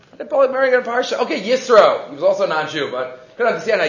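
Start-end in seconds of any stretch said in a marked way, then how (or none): 0.1-1.2 rattled off fast; quick restatement of the question to the class; fast repeat. Shown as a demonstration. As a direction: fire the same question off quickly.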